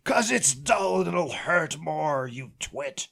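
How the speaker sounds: lightly voiced sheriff, slow and scornful; sheriff sneering. Sheriff to his cousin about the spoon, mild sneer. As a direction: drawl out the insult with contempt. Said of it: "he's like".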